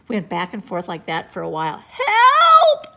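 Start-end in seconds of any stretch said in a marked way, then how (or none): 1.9-3.0 high, loud cry for help; yell for help. A narrator's reading. Andrea drowning in the ocean, yelling for help. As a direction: shout for rescue. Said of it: "yelled Andrea".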